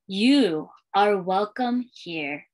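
put-on lilting read-aloud for young kids, refrain given room; storytime reading. Librarian reading a welcome book to schoolchildren, storytime lilt. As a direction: read it like a picture book to a class.

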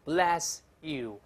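may God bless you, slow word by word; full careful form. Shown as a demonstration. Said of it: "写全了是".

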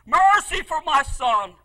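slow, loud cry of Mercy for my son; loud plea. Retold or imitated. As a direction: call out Mercy, drawing it out. Crying out for son.

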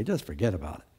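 a tired God shrugging them off, low and soft; weary God. Retold or imitated. Voicing God, weary of Israel.

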